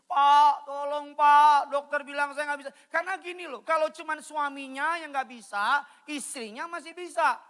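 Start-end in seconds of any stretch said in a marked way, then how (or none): 0.0-2.7 loud, high mock-sobbing plea; drawn-out, tearful cry for help, re-enacted; imitated wailing plea. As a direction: act out begging the pastor in tears. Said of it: "nangis kejer".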